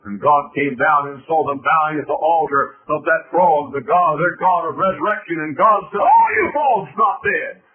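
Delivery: rising to a loud, high shout; full-force preaching. Acted. Preacher tells of God facing the frogs, building sermon shout.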